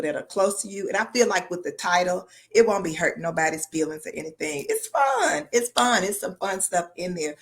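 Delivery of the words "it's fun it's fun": playful squeal. Real, not acted. Playful sell of the book.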